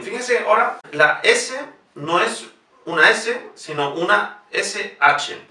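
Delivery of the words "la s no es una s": ordinary delivery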